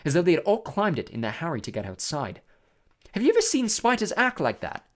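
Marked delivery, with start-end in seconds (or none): 3.1-4.8 higher, wondering question. A narrator's reading. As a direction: ask in mild amazement, pitched up. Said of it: "said Hermione wonderingly".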